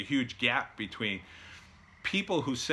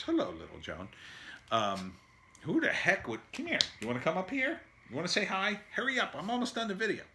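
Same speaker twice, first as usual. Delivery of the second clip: coaxing pet voice; 8 semitones higher, faster than usual. Real, not acted. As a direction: coax little Joan over gently. Talking to little Joan.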